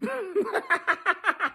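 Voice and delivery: high cackling laugh; gleeful laugh. Real, not acted. Fan cackling after taunting the Cowboys, gleeful laugh. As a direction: let the laugh burst out. Gloating over rival's loss.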